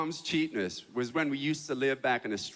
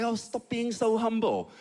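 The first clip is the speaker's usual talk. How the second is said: put-on high, slow Chinese-accented chiding; Chinese-accented mom; 6 semitones higher than usual. Chinese mom chiding her son for modesty, high Chinese accent. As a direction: wave away his modesty in a Chinese mother's accent. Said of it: "she's like".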